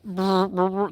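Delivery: buzzing lip-trill of hair clippers; short, rolled mechanical buzz; clipper sound. Retold or imitated. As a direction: imitate electric shavers buzzing. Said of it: "you know those, those".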